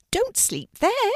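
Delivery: high, loud little rabbit voice; gentle rabbit calling out to a scared puppy; bright high rabbit, loud. A narrator's reading. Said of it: "said the rabbit".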